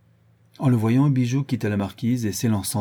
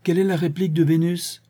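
high, raised call; loud chorus shout; 9 semitones higher, 5 dB louder than usual. A narrator's reading. Several voices repeat Jean's question, shouting it. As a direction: call it out at full voice, pitched high. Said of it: "plusieurs voix répétèrent à tue-tête".

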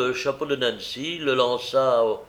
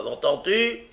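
bullying question, pitched up sharply; big gruff voice; 8 semitones higher, 3 dB louder than usual. A narrator's reading. Brigand presses the young woman to answer, big gruff voice. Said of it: "avec sa grosse voix".

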